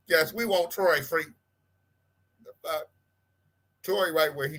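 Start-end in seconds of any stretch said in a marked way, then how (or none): none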